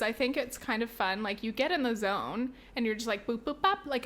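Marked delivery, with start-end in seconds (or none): 3.3-3.8 high playful boops; typing boops. Shown as a demonstration. Cashier typing orders, chirping playful boops. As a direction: chirp boop boop bop. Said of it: "I say that as I'm typing it".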